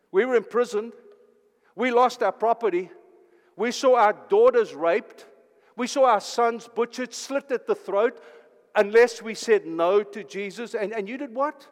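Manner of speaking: loud, rising outrage at the lines of suffering; anguished witnesses. Acted. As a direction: preach it as the martyrs' indignant protest. Witnesses confront the unfaithful believer.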